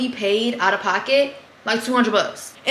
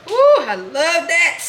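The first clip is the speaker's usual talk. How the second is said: delighted ooh; 7 semitones higher, 5 dB louder, much slower than usual. Real, not acted. Reacts to smell of fish.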